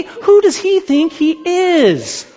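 high, slow, scandalized huff; hammed-up outraged rabbi; mock indignation. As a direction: play the offended rabbi. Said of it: "Because he didn't just do that".

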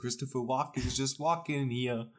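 put-on Brooklyn accent. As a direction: loose New York voice. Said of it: "my Brooklyn accent".

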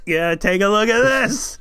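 high, loud, slow gloating; triumphant taunt. Retold or imitated. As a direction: show off to the attacker, slowly. Joining the taunt.

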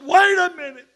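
high, loud call to stop everyone. Retold or imitated. Old preacher stopping the service from the platform, calling out loudly. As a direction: jump up and cry out for them to wait.

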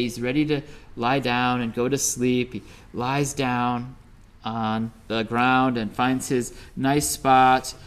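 ordinary delivery, nothing marked

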